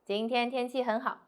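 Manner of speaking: robotic, level 今天天气很好; no stress. Shown as a demonstration. Teacher repeating the bad example, 今天天气很好 without stress. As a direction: keep all of 今天天气很好 equally weighted, like Siri. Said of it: "Very like a Siri said".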